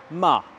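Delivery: MA loud, starting high and dropping sharply; authoritative falling tone. Shown as a demonstration. Teacher turning a French command into the fourth tone, authoritative fall. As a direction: say Ma like a firm order. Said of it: "On part du haut et on descend vers le bas".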